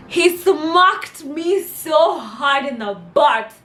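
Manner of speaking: high, loud, slow indignation; outraged emphasis. Real, not acted. Storyteller recalls being caned by her teacher, outraged. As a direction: draw out every word in outrage.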